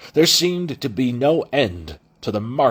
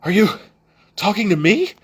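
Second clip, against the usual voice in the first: breathless, higher question; puffing between the words; out of breath; 10 semitones higher, 4 dB louder than usual. Acted. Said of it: "Kaladin puffed".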